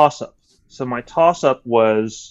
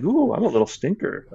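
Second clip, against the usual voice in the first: playful stinker; 6 semitones higher than usual. Retold or imitated. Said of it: "that Prince-Chivas, uh, look".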